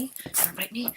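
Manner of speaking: soft whispering tease to the dog; whispered tease. Real, not acted. Teasing the dog in a soft whisper. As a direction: hush it playfully.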